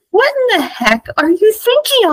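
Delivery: high, incredulous scolding; acting out a friend's outraged rebuke; exasperated outburst. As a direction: demand it in high-pitched disbelief. Mock scolding August.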